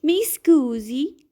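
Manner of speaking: "Mi scusi" spoken slowly, mee SKOO-zee; slow Italian demo. Shown as a demonstration. Teacher modelling apology phrase for viewers, slow and clear. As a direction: stretch out "Mi scusi" for learners. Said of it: "Next is per favore".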